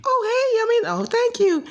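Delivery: put-on flattered girl, squeaky high. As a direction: gush in a mock girly falsetto. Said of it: "And she'd be like".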